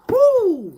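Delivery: a sudden high, loud "woo" of amazement; high whooping exclaim. Real, not acted. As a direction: let out a high startled woo. Reacting to a striking card.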